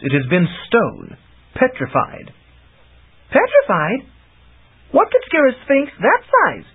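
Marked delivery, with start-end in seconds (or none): 3.3-4.0 "Petrified?" asked high with a rising lift; wondering. Acted. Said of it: "Chameleon wondered". 4.9-6.6 high, curious question; wondering aloud. Acted. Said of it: "Chameleon wondered".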